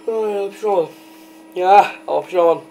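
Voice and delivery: whining about the sneeze that won't come; frustrated whine. Real, not acted. Stuck sneeze, whining to viewers in frustration. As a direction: complain like the sneeze just got stuck.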